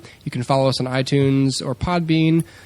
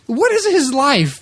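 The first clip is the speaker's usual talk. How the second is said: disbelief; 13 semitones higher, 5 dB louder, slower than usual. Retold or imitated. Retelling his own disbelief.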